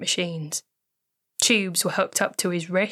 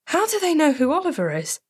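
much higher, alarmed question; panicked disbelief; 9 semitones higher, 3 dB louder than usual. Acted. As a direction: blurt it out in shock. Melissa panicking.

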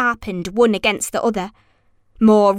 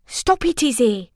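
high, urgent protest; boy objecting anxiously; 7 semitones higher than usual. A narrator's reading. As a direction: protest in a raised pitch. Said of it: "protested Ewan".

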